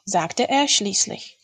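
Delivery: ordinary delivery, nothing marked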